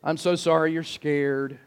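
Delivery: gently soothe, in pretend tenderness; hushed, coddling reassurance; mock sympathy. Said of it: "No, he was mad".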